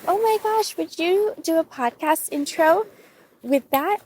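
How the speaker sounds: high-pitched, eager quote of a friend; imitating excited friend. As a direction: pitch it up like an enthusiastic friend's request. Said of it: "She's like".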